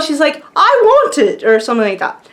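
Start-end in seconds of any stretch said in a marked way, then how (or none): 0.6-1.4 put-on high-pitched little-girl demand in a British accent; mock tantrum, bad British accent; screaming British toddler. Retelling a three-year-old's tiara tantrum, mock British screaming. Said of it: "she's, like, screaming".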